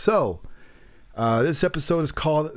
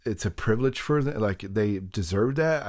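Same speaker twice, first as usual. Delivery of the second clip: usual speaking voice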